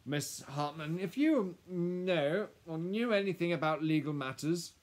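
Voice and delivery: high, loud, hesitant lawyer; nervous. A narrator's reading. Lawyer changing the subject with Miss Hartman, nervous. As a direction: bluster on to cover the awkwardness. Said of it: "He shuffled his papers in his hands nervously".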